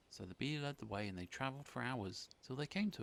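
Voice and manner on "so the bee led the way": plain reading voice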